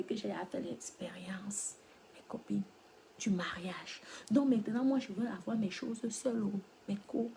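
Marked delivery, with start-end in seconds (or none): none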